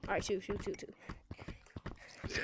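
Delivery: hushed whisper prompting. Real, not acted. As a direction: whisper it under the breath. Prompting a shout out.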